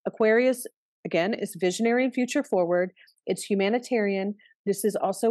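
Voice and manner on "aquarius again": ordinary talk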